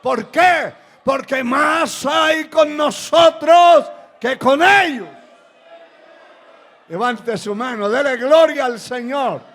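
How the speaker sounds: full-force preaching. Acted. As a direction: shout it to rouse the congregation.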